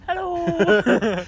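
very high, loud, squeaky greeting; high squeaky hello. Shown as a demonstration. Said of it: "Talk properly".

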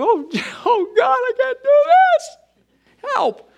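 high, loud, panicked plea with a nervous laugh; desperate cry to God. Retold or imitated. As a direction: cry out like you're in over your head. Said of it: "you're scared".

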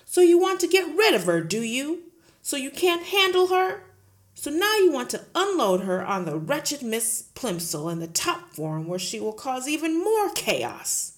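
loud, booming headmistress; bully the teacher at full volume; Trunchbull bullying. Acted. Headmistress roaring at Miss Honey, loud and bullying.

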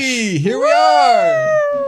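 dramatic announcer. Acted. Dramatic episode milestone.

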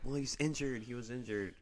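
lightly voiced defensive fan; a hint of a protesting fan, kept quiet; Okada fan making excuses. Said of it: "if you're an Okada fan, you're like".

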